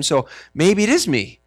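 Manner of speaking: high-pitched dawning doubt; acted worried self-questioning; unsure. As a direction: let the doubt lift the voice. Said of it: "they're unsure of themselves".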